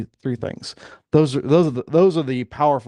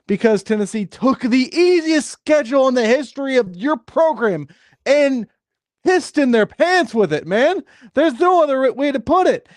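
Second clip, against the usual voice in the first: high-pitched, heated venting; exasperated rant; 11 semitones higher, 4 dB louder than usual. Real, not acted. Fed-up fan explaining to co-host why he's unhappy, exasperated rant. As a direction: rant it out in a raised, indignant voice.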